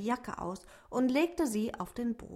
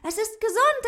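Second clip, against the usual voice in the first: much higher, loud cheer rising on gesund; 13 semitones higher, 9 dB louder than usual. A narrator's reading. Ute cheers that the rabbit is healthy, rising on "gesund". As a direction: shout for joy, lifting "gesund". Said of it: "Ute jubelte".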